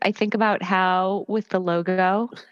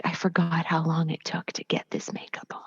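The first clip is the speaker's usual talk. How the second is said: whispered grumble, imitating an actor; muttered, whispery complaint; cursing under breath; 4 semitones lower, 6 dB quieter than usual. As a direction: grumble it low, barely voiced. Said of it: "sort of cursing under his breath".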